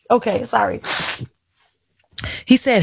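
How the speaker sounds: usual speaking voice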